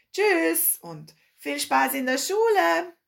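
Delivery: high, drawn-out farewell call; calling after her. A narrator's reading. Older couple to girl leaving for school, calling after her. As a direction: sing out a cheery goodbye across a distance. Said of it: "riefen ihr noch nach".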